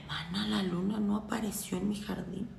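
deflated, almost to herself; deadpan lament. Real, not acted. Witch telling friends her moon failed, deflated.

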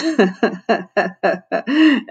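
acted boyish chuckle, Calvin's reaction. A narrator's reading. Calvin breaks the silence after the joke, chuckling. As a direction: chuckle along in a short run of ha-has. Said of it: "Then Calvin chuckled".

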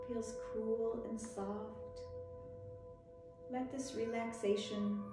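normal talking voice

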